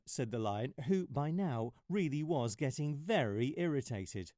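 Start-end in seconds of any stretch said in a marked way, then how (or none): none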